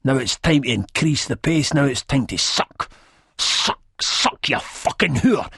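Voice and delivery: forceful, menacing character voice; aggressive command. Acted. Villain barking orders in narrated scene.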